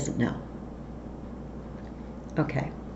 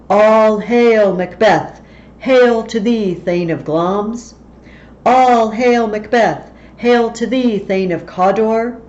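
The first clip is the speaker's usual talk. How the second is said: loud, higher proclamation, slow on each title; booming witches; 4 semitones higher, 7 dB louder, slower than usual. Acted. The witches greet Macbeth with a loud proclamation. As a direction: hail him like a prophecy.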